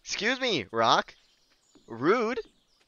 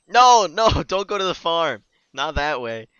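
much louder, higher protest; yelling at the screen; 5 semitones higher, 15 dB louder than usual. Real, not acted. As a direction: shout no at the game character. Yelling at character going wrong.

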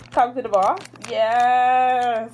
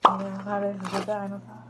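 normal talking voice

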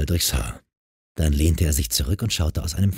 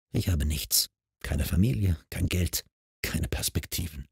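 softer and softer, fading to nothing; despairing; 8 dB quieter than usual. A narrator's reading. Evi listing what she lacks to Mark, fading quiet despair. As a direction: let each item sink quieter.

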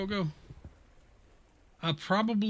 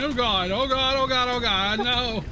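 panicked yelling; 8 semitones higher, 5 dB louder than usual. Real, not acted. Teammates dying in game.

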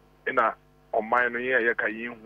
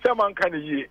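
heated; 11 semitones higher, 7 dB louder, faster than usual. Real, not acted. Heated repetition in argument.

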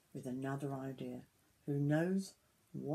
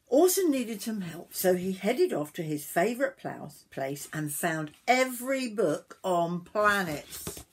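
animated, louder storybook reading; story narration to children; 3 semitones higher, 10 dB louder than usual. A narrator's reading. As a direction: tell it with big emphasis.

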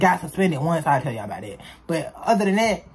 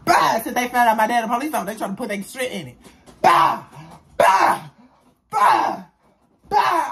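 mock pained yelps; 3 semitones higher, 4 dB louder than usual. Screaming as the licks land.

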